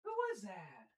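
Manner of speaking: soft cooing dog talk, often high. Real, not acted. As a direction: coo softly at the dog, curious. Talking to the dog.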